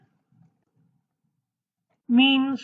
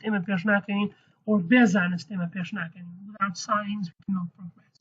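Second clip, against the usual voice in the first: ordinary delivery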